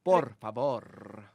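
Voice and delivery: put-on slow, drawn-out Spanish accent; exaggerated Spanish accent. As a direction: stretch Por favor in a Spanish accent. Echoing the Spanish slowly.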